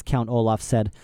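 ordinary delivery

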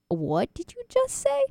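high, slow stunned question; shocked disbelief. Retold or imitated. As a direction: recoil in disbelief. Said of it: "he's just like".

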